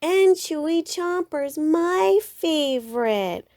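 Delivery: high whine, dragged very slow on my favorite; whining Brother. A narrator's reading. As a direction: stretch out my favorite like a begging cub. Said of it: "whined Brother".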